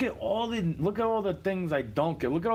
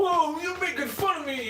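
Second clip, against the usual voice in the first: much higher, hammed-up wail of complaint; mock crybaby whine; 11 semitones higher than usual. Ranter mimicking someone who can't take a joke, high mock whine. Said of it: "you start crying about it".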